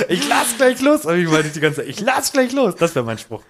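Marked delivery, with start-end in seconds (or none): 0.1-1.0 high, louder hammed-up warning shout; mock strained cry. Comic re-enacts carrying a sofa when moving, high mock cry. 1.9-2.7 mock strained cry. Comic re-enacts carrying a sofa when moving, high mock cry.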